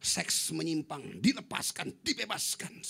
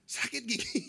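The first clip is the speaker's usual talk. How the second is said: higher-pitched little whine; acted toothache complaint; 8 semitones higher than usual. Preacher recalling his toothache, higher-pitched complaint.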